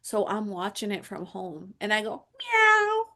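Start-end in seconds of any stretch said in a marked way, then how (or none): 2.4-3.0 high, drawn little mock meow; cat meow. Watching the client on camera from home, high teasing meow. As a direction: imitate a cat, pitched way up.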